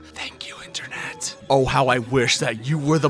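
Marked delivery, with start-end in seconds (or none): 0.0-1.4 hushed whispered thanks; whispered aside. Real, not acted. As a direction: sneak the thanks in under your breath. Aside crediting a code source.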